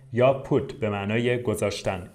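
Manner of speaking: normal talking voice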